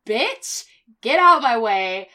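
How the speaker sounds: indignant retold snap, rising on the first word; angry comeback. Retelling her angry comeback.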